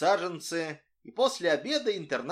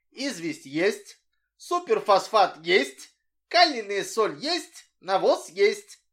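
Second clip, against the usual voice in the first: slow, higher and louder, counting off each item; proud listing; 5 semitones higher, 7 dB louder, slower than usual. A narrator's reading. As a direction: tick them off on your fingers, proudly. Boy proudly ticking off items.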